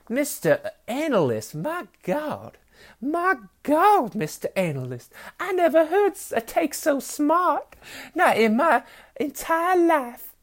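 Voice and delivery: high, slow, drawn-out Southern belle. Acted. Fan to NFL analyst about his hot take, mock Southern belle. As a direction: gush in a hammed-up Southern belle falsetto. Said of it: "the deal with the Southern Belle".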